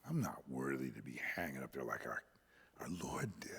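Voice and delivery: soft, low, fading to a whisper; humble hush. Retold or imitated. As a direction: say it as if unworthy, barely aloud. Peter's humble words, hushed.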